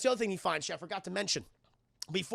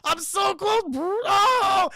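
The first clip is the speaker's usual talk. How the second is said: loud, high, mock-agonized strain; desperate straining; 11 semitones higher, 8 dB louder, slower than usual. [name] struggling toward the elevator, loud high desperate straining. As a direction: strain upward like you're about to shit. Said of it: "Like he's about to shit".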